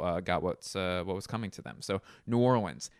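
ordinary talk

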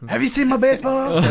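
high, loud goofy whine; whiny, dim man-child voice; There's Something About Mary brother. Retold or imitated. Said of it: "Have you ever seen the brother in There's Something About Mary".

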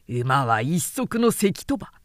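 ordinary delivery, nothing marked

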